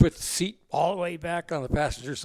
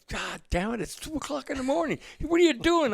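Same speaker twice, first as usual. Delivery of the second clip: much higher, exasperated outburst; woken-up anger; 10 semitones higher than usual. Retold or imitated. Stu, woken at 2 a.m., yells back at Evil, much higher. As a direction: snap at the caller who woke you. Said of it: "And I'd say".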